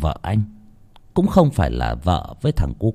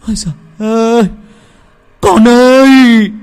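anguished wail; 13 semitones higher, 8 dB louder than usual. Acted. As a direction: cry out in pain for the lost child. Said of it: "anh kêu lên đau đớn".

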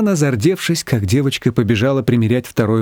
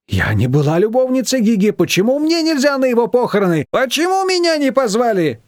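rising, higher indignant protest; 10 semitones higher than usual. A narrator's reading. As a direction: build up offended outrage. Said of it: "возмутилась Маечка". (Маечка возмущается, что её не позвали, всё выше)

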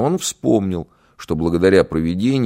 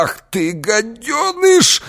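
angry shriek; 3 dB louder, much slower than usual. Acted. Bitten man curses the boy.

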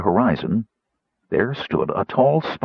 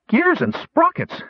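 very high, drawn-out gnome cry; high-pitched gleeful exclamation; crowing with delight. A narrator's reading. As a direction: crow it out in a high little voice. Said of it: "Fitter crowed".

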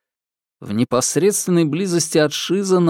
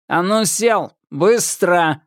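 metallic patrolman; 6 semitones higher, slower than usual. Acted. Patrolman orders at gunpoint.

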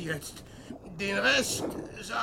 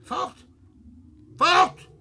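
frightened shout; 8 semitones higher, 8 dB louder than usual. Acted. Peer stößt den Fremden weg.

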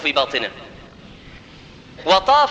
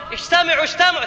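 high-pitched summons to a crowd; calling out; 7 semitones higher, faster than usual. Acted. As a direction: call everyone to gather, pitched up high. Safwan summoning the people of Quraysh. (نداء الناس بنبرة عالية)

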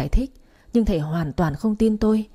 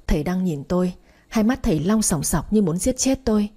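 plain reading voice

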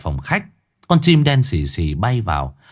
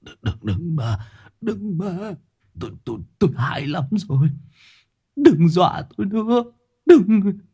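terrified rasping plea; 8 semitones higher than usual. Acted. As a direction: gasp out the pleas to the ghosts in terror. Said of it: "mồm cứ há lên khó nhọc mà rít".

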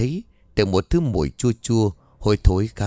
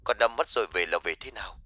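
much softer, distant phone-line voice; 10 dB quieter, faster than usual. A narrator's reading. Lover answering her late-night call, heard over the phone. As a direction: sound like he's coming through a phone receiver. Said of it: "giọng của ông ở đầu dây bên kia".

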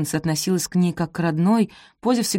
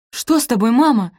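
higher, louder worried question; alarmed; 7 semitones higher, 6 dB louder than usual. A narrator's reading. Daughter asks her mother what is wrong, alarmed. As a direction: ask it with rising alarm. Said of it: "встревожилась Пози".

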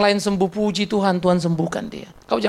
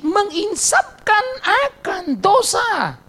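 high, slow, every word of "menginsapkan akan dosa" stretched out; drawn-out emphasis; 12 semitones higher, much slower than usual. Acted. To the congregation, preacher hammers home the key point, slow and high. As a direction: lean hard on "akan dosa" slowly.

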